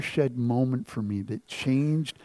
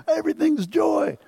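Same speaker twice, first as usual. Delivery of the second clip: much higher, mock-bright gushing praise; hammed-up higher happy-clappy voice; fake cheerful worshipper. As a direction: parody the forced-happy churchgoer. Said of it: "everyone else is like".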